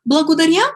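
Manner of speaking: "Благодаря" said high and loud, rising; clear teaching demo. Shown as a demonstration. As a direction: model "Благодаря" for the class. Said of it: "it's like, "Thank you".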